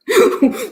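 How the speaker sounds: sudden high-pitched cackle; high mad cackle. Acted. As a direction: burst into a shrill crazed laugh. Madman boasting of his wisdom.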